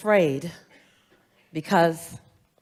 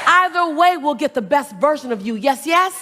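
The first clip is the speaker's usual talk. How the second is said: motivational preaching; 5 semitones higher, 7 dB louder than usual. Acted. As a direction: preach it to the crowd. Motivational call and response.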